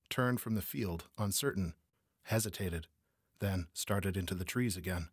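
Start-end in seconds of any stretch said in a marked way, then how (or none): none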